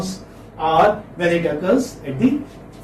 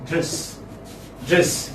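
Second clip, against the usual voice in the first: normal talking voice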